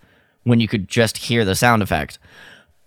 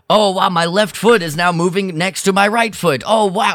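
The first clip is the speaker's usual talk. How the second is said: high, hammy in-character reading; mock hammy narration; 9 semitones higher than usual. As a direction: overact every tiny move, much higher. Mocking in-character scene reading.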